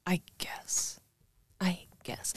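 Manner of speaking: unvoiced resigned whisper. Real, not acted. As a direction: mutter it under your breath.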